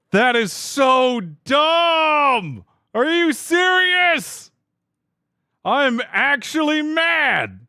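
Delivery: high, slow, loud drawn-out complaints; real frustration, wailing each phrase; angry whining. Real, not acted. Streamer admits to chat he is actually mad, high and drawn out. Disconnected from Steam mid-battle.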